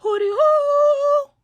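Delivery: very high, loud, drawn-out hooting call; grandma's hootie hoo call. Retold or imitated. Grandma pulling up on Seven Mile, loud drawn-out hoot call. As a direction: hoot it out slow like a street call. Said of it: "My grandma go".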